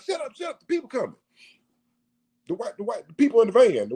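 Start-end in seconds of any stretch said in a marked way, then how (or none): 0.0-1.2 soft, high urgent hushing; hushing lion. Retold or imitated. Lion warning that people are coming, high urgent hush. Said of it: "The lion is like".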